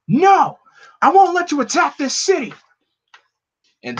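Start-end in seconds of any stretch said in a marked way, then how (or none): 0.1-2.5 high, loud heroic yell; defiant shout. Acted. Hero defies Batman.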